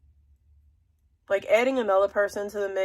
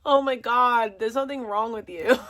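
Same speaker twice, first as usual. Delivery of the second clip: usual speaking voice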